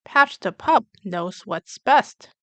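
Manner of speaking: usual speaking voice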